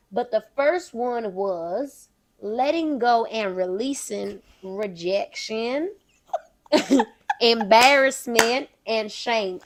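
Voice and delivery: Southern belle giving advice; Southern accent. Pretend. Southern belle explains her confidence rule, accent kept up. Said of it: "I'ma keep the accent".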